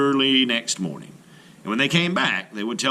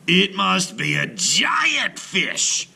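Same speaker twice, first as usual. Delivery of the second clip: loud, higher, slow shout; 9 semitones higher, 4 dB louder, much slower than usual. A narrator's reading. Brother shouts at the rising catch, slow loud shout. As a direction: cry out in amazement. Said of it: "shouted one brother".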